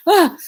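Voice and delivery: high, loud exasperated "ah"; short mock scream of frustration; mock frustrated cry. Girlfriend reacting to boyfriend delaying marriage, mock-frustrated.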